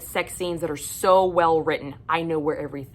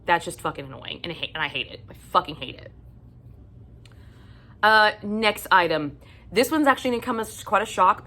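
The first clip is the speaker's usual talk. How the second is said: usual speaking voice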